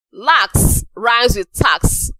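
slow, stretching the rhyme; slow demonstration. Shown as a demonstration. Teacher showing students that lax rhymes with tax, slowly. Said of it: "you can see that relax and tax".

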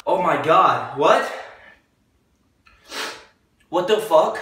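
startled alarmed outburst; shocked. Real, not acted. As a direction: caught off guard, rising alarm. Sudden bloody nose shock.

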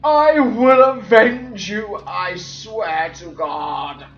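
slow, loud vow starting high; melodramatic vow. Acted. Player swearing revenge for lost characters, slow melodramatic cry. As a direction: declaim it drawn out, high at first and coming down.

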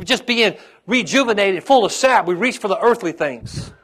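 loud, fervent preacher's push; preaching. Acted. Pastor urging the congregation to be full of sap, fervent. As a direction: drive the point home to the church.

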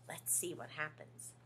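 soft suspenseful whisper; whisper, build suspense. Real, not acted. Reader hushed aside.

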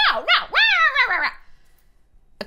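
imitating his rant, high and loud then slow; mock explosion. The ex exploding when confronted, imitated high loud rant. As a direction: ham up his angry outburst. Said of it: "And he exploded".

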